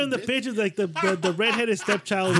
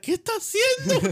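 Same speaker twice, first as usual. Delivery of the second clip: high, louder startled Spanish question; shocked question in Spanish; 4 dB louder than usual. Retold or imitated. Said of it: "And we're like".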